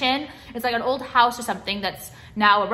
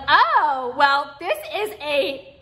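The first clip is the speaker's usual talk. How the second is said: slow, higher, put-on waiter voice; mock waiter; 4 semitones higher, much slower than usual. Imagined waiter tells California tourists, slow put-on voice. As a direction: mimic a smug waiter announcing the menu. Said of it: "the guy tells them".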